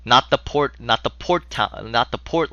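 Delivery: ordinary delivery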